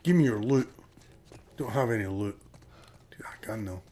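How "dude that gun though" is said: hushed whisper about a gun. Real, not acted. Gamer sneaking past enemies, whispering to teammate. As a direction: whisper so the enemy won't hear. Quiet remark mid game.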